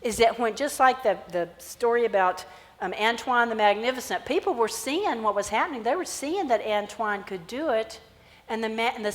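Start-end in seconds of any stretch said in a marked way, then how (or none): none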